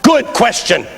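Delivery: high, ringing sermon delivery; full-force preaching. Real, not acted. Preacher challenging a congregation on sacrifice, forceful high preaching. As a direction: preach it hard, pitch held high.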